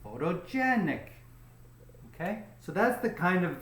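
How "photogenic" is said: pho-to-GEN-ic, stressed syllable held; stress on third syllable. Shown as a demonstration. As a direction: lean on the GEN in "Photogenic", then fall. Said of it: "So the stress goes here".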